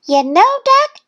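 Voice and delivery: high, loud little-duck voice; Thistle's bragging voice. A narrator's reading. Thistle about to brag to Duck, high voice. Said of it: "Thistle said".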